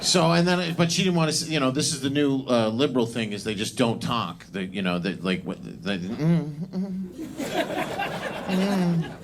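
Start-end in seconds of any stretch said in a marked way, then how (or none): 6.0-9.1 mocking closed-mouth hum. Imitating silent liberal women.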